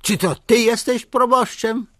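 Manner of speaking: a furious official's roar. A narrator's reading. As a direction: bellow it at the priest. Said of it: "ryknął".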